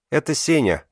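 put-on high, loud little girl's voice; loud child announcement. Little Lera proudly tells the grown-ups about Senya, loud. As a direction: announce it loudly like a proud small girl. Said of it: "громко заявляет Лера".

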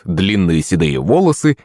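plain reading voice

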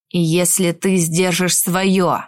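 low, slow menacing growl. Acted. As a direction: growl the threat back. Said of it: "рыкнул Гашар".

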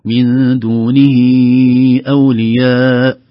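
دونه with the final ha drawn long; very slow chanted دونه أولياء; long silah. Shown as a demonstration. Teacher showing the long ha of the pronoun. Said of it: "لاحظوا".

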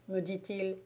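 plain reading voice

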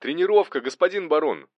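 high, loud, chipper report; cheerful. A narrator's reading. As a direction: report brightly to your superior. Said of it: "Голос Арсения звучал весело".

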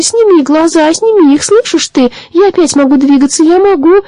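scream. A narrator's reading. The little sprite screams at the fish in his nightmare. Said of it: "закричал маленький водяной".

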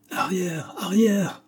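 high, abrupt warning cry, held back in volume; impetuous cry. A narrator's reading. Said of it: "cria-t-il avec cette impétueuse brusquerie". (cri brusque et impétueux, aigu mais contenu)

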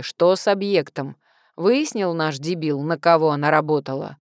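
usual speaking voice

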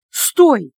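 loud one-word command; sharp call. A narrator's reading. Ilya stopping Anya from hanging up, shouted. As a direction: call out to stop the caller hanging up. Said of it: "окрик Ильи".

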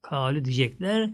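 ordinary talk, nothing marked

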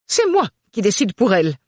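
loud, high fussy mother; bossy matron pushing her daughter; overbearing mother. Acted. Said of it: "en gonflant son énorme poitrine".

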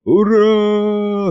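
long, high hurrah; high drawn-out mock crowd yell; crowd cheer. A narrator's reading. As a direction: hold the cheer on one note. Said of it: "снова завопила площадь".

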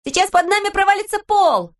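Pippi yells in alarm; shouting girl. A narrator's reading. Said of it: "Пеппи закричала". (испуганный крик девочки)

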